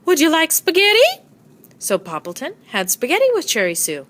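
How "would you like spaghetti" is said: high sing-song yoo-hoo call. A narrator's reading. Neighbor calling over the fence in a high sing-song. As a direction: call across to the neighbor brightly. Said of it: "she called out".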